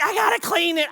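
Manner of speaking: high, loud excited voicing; breathless rush of excitement; excited daughter. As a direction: daughter eager to clean her find. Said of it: "She's shaking".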